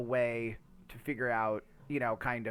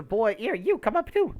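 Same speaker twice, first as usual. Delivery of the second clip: calling out; 10 semitones higher than usual. Real, not acted. Calling a pet in a game.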